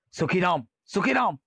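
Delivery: high, urgent shout of a name; calling out. Acted. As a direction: cry out to warn him. Station master shouting to Sukhiram. (Sukhiram को पुकारता station master, ऊँची घबराई पुकार)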